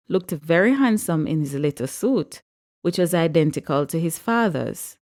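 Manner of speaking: usual speaking voice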